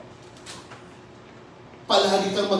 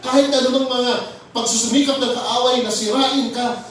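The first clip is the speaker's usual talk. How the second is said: raised, ringing sermon voice, pressing each phrase; fervent preaching; 8 semitones higher, 5 dB louder than usual. Acted. Pastor rallying his congregation with fervent preaching. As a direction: preach it with full conviction to the flock.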